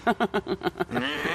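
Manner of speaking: scoffing laugh. Acted. Scoffing at brother's claim.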